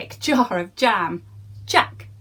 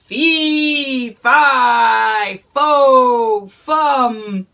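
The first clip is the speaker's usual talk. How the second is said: loud, higher booming roar; roaring giant; 4 semitones higher, 8 dB louder, much slower than usual. Acted. Giant chasing Jack, roaring loud. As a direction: roar each word after Jack. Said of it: "roared the giant".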